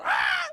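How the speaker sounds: anguished cry. Retold or imitated. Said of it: "en silicio delante de Dios".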